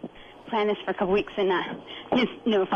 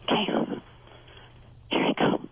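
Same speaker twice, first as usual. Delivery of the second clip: whispering. Real, not acted. Sneaking upstairs to wake husband.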